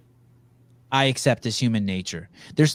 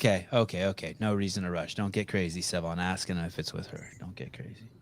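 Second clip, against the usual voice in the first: low, soft aside to himself; muttered self-talk; 6 semitones lower, 10 dB quieter than usual. Real, not acted. Host checking his own eagerness to question a guest, muttering to himself. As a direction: talk yourself down under your breath, trailing away.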